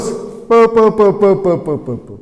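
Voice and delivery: प as a lone consonant with a short vowel, repeated; repeated p sound. Shown as a demonstration. Teacher demonstrating the p sound to the class, प repeated in a string. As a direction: tap out प over and over as a separate sound. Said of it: "प sound है".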